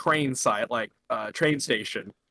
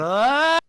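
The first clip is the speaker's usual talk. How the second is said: high human-like lynx scream, imitated; 9 semitones higher, 4 dB louder than usual. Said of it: "when lynxes scream, it just sounds like a person".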